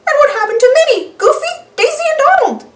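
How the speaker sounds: high, worried mouse voice; a squeaky cartoon mouse asking anxiously; Mickey voice. Mickey worried about missing friends.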